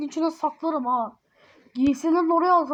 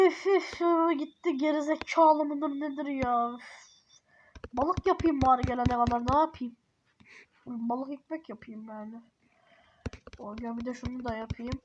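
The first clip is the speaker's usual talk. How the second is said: ordinary delivery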